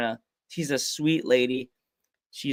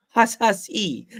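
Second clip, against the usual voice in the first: wrestler catchphrase; 6 semitones higher, slower than usual. Retold or imitated. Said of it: "Wrestling term".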